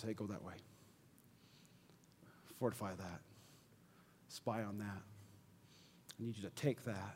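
very low, soft, spaced phrases; hushed. Acted. Preacher relaying what God says to do, hushed and low. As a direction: drop right down to a near murmur. Hushed turn to fear and God's prompting.

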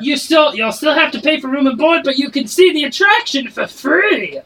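high, loud barking tavern keeper; a barkeep shouting from behind the bar; dwarf barkeep. Acted. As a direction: bark orders at the kitchen staff. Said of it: "His voice does not match his description".

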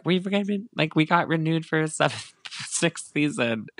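the show account's chirpy renewal post, gently mocked; mock announcement. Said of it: "They were like".

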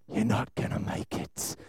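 whispered taunt of the devil; devil's taunt. Retold or imitated. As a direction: breathe it like a tempter in the ear. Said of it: "and he'd go".

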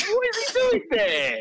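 high, slow disbelieving question; incredulous. Real, not acted. Laughing at Terry in Africa, incredulous. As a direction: ask it as if it's absurd.

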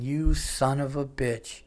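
slow, drawn-out muted cursing; retold exasperated curse. Owner realizing his dog is the culprit, slow muted curse. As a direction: draw it out in dawning exasperation.

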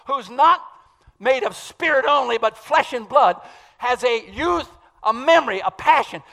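loud, high, fervent preaching; full-force preaching. Acted. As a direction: hammer the message home to the congregation.

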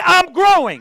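loud, high call of I'm growing; rousing preacher's shout; shouted declaration. Real, not acted. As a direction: shout it out so the whole church joins. Congregation call and response.

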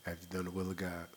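very soft, low, no force at all; soft mumbled reply. Retold or imitated. Preacher mocking brother's weak answer, very soft and low. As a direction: mimic the timid answer, barely audible. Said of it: "Where all that force, brother?".

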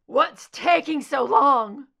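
loud, high desperate yell; hoarse shout. Acted. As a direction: shout for help across the room. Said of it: "His hoarse shout".

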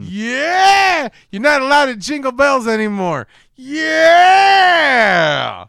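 high, loud hammed-up holler; exaggerated imitated shouting; mock villain yell. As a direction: yell it out long like a crazed villain. Acting out villain's shouted cry.

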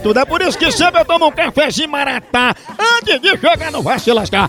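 upbeat radio host reading a live coffee ad; punchy radio announcer pitching coffee. Acted. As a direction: sell it like a lively radio spot. Radio host's live ad read.

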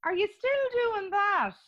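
high-pitched, drawn-out mock enquiry; nosy guest texting. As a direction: put on a high, prying well-wisher's voice. Acting out nosy texts from guests.

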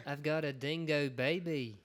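slow, hammed-up Australian accent, badly faked; mock Australian drawl, dragged out; a clumsy Australian accent, played for laughs. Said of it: "that's not even right".

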